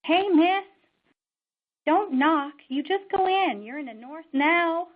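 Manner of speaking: loud high-pitched call to a passerby; hollered, friendly heads-up from afar; retold yell. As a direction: yell it out like a young man from a snowmobile. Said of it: "he yelled out to me".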